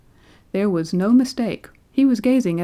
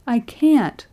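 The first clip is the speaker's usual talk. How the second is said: high, louder despairing repeat; father moaning in despair, voiced higher; 5 semitones higher, 5 dB louder, much slower than usual. A narrator's reading. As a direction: plead against the impossible. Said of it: "he moaned".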